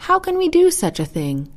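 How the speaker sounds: high, doubtful question; puzzled question. A narrator's reading. Geese doubt the turtle can travel along, puzzled question. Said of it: "asked the birds".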